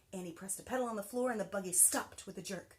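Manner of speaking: plain reading voice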